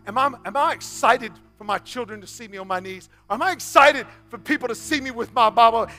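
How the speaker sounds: usual speaking voice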